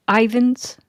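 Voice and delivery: usual speaking voice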